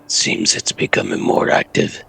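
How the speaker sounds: hushed, breathy whisper. Acted. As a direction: whisper it so only Tarm hears. Whispered in-character line at sewer.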